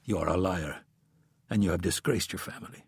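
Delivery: low, quiet, cold killer voice; calm menace, very quiet; cold assassin. A narrator's reading. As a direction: assassin speaks to his victim, quietly.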